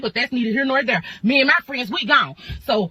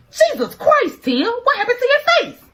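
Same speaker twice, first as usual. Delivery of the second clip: shocked boss; 12 semitones higher, 3 dB louder than usual. Retold or imitated. Boss sees her bruised face.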